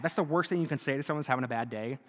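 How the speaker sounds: ordinary delivery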